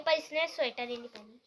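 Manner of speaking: usual speaking voice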